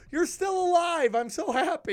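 high, loud overjoyed squeal; excited gushing. Retold or imitated. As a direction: gush at her like a thrilled fan. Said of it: "I literally go".

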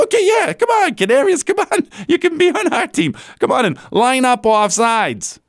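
high-pitched mock team voice, coaxing; mock eager welcome. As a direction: imitate an over-eager team waving a player in. Voices Chiefs welcoming Kadarius Toney.

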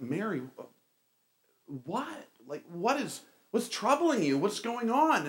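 halting, then high-pitched questions; hesitant start rising into puzzled concern; acted concerned questioning. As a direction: act out an awkward, worried inquiry. Acting his stage role, questioning Mary.

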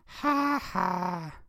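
high, mocking two-note ha-ha; Nelson. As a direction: jeer with a high cartoon laugh. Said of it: "And Nelson said".

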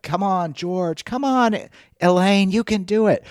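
high, slow encouraging cheer; cheering crowd. Retold or imitated. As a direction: call out like a cheering spectator. Cheering spectators imitated.

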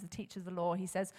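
ordinary talk, nothing marked

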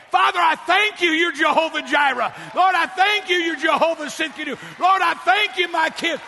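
loud, bold declaration of thanks; bold prayer. Shown as a demonstration. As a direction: proclaim it boldly to God.

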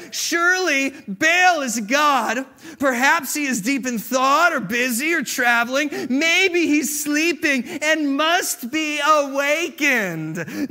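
high, loud, slow sarcastic jeer; mock them to their faces; mocking taunt. Elijah taunting Baal's prophets, slow, loud sarcastic jeer. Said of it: "Elijah began to taunt them".

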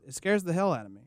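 usual speaking voice

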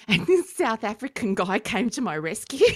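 laughing through the words. Real, not acted. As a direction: tell it while laughing. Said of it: "laughs heard".

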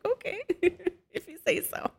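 high, giggly mock agreement; amused, doubting. Mother humouring her oldest son, laughing high-pitched doubt. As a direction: go along with it through a laugh. Amused reply to son's compliment.

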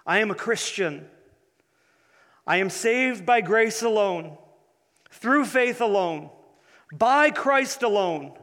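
building, fervent declaration; rising declaration. Shown as a demonstration. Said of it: "Listen, listen to your testimony".

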